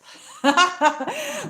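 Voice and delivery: high, loud burst of laughter; laughing. Real, not acted. Amused at her own trip idea.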